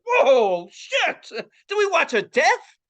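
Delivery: loud, high, awestruck excitement; shocked thrill. Retold or imitated. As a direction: blurt it from horror into delight. Reenacts shocked glee at explosion.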